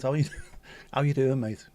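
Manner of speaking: put-on jokey Australian accent with a laugh. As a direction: greet a mate in a light Australian accent. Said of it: "say it in an Australian accent".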